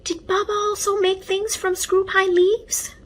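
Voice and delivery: put-on high child's curious question; a small boy asking eagerly; curious little boy. A narrator's reading.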